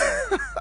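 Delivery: short, soft, high-pitched laugh; a quiet giggle slipping out; real laugh. Real, not acted. Radio host caught laughing on air, soft helpless giggle. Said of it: "I don't know why I'm laughing".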